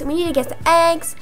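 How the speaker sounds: ordinary talk, nothing marked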